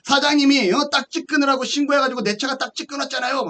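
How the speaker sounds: retold phone accusation, indignant and high; angry caller. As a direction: voice the annoyed caller. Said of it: "막 화를 냅니다".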